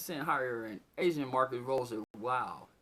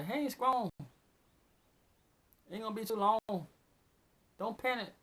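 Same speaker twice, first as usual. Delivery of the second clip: lightly voiced calm expert, pitched higher; a hint of a know-it-all adviser, higher; mock expert advice; 5 semitones higher than usual. Mocking the so-called experts who say hang strong, lightly voiced and pitched higher. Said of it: "so-called experts say".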